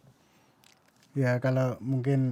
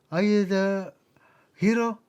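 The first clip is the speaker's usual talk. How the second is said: imitated scornful challenge, higher pitch; mock angry questioning; 5 semitones higher, slower than usual. Grave dwellers questioning the newcomer, mock angry. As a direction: challenge the intruder with raised pitch. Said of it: "Pada marah-marah".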